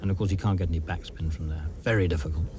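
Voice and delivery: hushed live golf commentary; golf commentator. Real, not acted.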